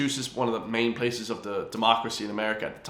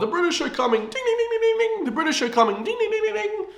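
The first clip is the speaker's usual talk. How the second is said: high sing-song cry with bell dings; town crier alarm. Retold or imitated. Mimicking the Minutemen ringing the bell, high sing-song alarm. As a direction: call it out like a bell ringer raising the alarm.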